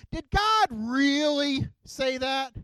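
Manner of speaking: high, loud and slow, drawn out like a sly serpent; the snake teasing Eve, stretching every word; tempting snake voice. Acted. Snake questioning Eve, high, loud, slow and sly. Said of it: "the snake says to Eve".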